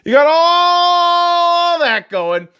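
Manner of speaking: high, loud and very slow; drawn-out disbelief. Real, not acted. Fan ranting at the club's choices, drawn-out disbelief. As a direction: stretch every word in disbelief.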